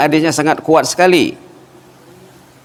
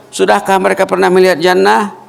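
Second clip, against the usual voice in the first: raised questioning voice; 7 semitones higher, 3 dB louder than usual. Acted. Preacher voices God questioning angels.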